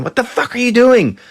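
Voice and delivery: high mock shout; hammed-up startled yell. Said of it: "Ray and Brad rush in".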